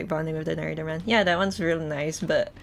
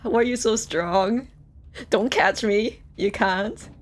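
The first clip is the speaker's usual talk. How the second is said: mock swooning; 4 semitones higher, 6 dB louder than usual.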